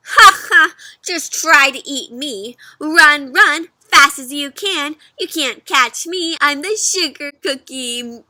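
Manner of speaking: loud, laughing sing-song taunt; mock him while running away; taunting cookie. Cookie laughing at the chasing fox.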